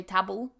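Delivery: "table" with a short a, as in "tabble"; mispronounced table. Shown as a demonstration. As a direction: say "table" rhyming with "babble". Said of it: "has mispronounced the word table".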